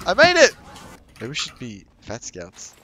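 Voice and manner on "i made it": triumphant shout. Real, not acted. Triumphant after a jump.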